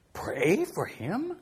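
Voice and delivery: slow, rising disbelief; a boy's slow, baffled question; incredulous. Retold or imitated. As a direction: stretch it out like it's absurd. Ivan stunned by advice.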